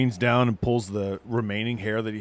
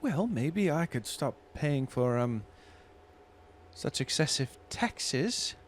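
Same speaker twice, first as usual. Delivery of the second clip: sly barmaid voice; 6 semitones higher, slower than usual. Acted. GM voices barmaid hinting at taxes.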